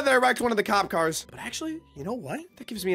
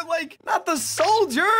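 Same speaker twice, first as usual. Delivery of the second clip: high, loud, drawn-out wail; over-the-top dismay; dismayed protest; 8 semitones higher, 5 dB louder, slower than usual. Real, not acted. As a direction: protest slowly and loudly in disbelief. Protests the soldier being taken away.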